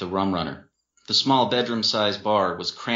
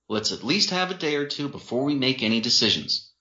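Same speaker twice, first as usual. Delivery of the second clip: raised, higher-pitched reasoning; insistent boyfriend; 6 semitones higher than usual. A narrator's reading. Boyfriend urging everyone to sober up, insistent. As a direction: firmly lay out a compromise. Boyfriend takes charge of quarrel.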